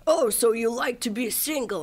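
lightly mocked accusing boyfriend; imitating boyfriend. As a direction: quote him as if catching you out. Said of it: "he would be like".

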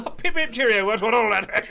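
high, loud hammed-up British toff; mock British accent. Joking about British show.

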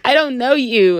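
laughing through the words. Real, not acted. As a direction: giggle as you repeat it. Repeats her retort while laughing.

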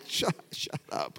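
hushed. Retold or imitated. Wife hushing him.

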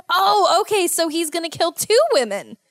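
suddenly grasp how much worse it is; surprised realization. Real, not acted. Hearing the best friend comes on the date, alarmed surprise.

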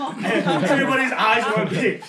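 loud, slow, through laughter; laughing. Real, not acted. Teller recalls the crowd's shock, laughing loudly. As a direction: tell it while cracking up. Cracking up at own story.

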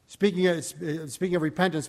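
normal talking voice

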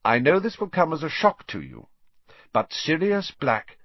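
serious and emphatic, slowing at the end. A narrator's reading. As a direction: deliver serious news with deliberate weight. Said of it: "in a very serious voice".

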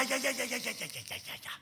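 rapid repeated plea fading to a whisper; acted melting under flattery; God giving in. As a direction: start high and soft, dwindle away. God overwhelmed by praise, giving in.

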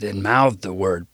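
ordinary delivery

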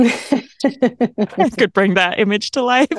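slow, booming, hammed-up preacher; mock the big pulpit voice, dragging it out. Joking she can't sound preacher-like.

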